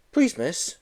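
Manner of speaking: put-on a skinny kid asking politely; small boy.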